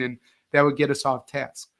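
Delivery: ordinary talk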